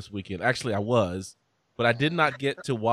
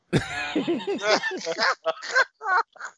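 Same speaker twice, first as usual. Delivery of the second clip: long high-pitched laugh. Real, not acted. Laughing at own confession.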